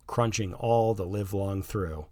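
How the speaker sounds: ordinary delivery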